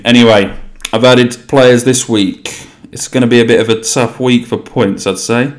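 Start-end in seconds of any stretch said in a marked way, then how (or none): none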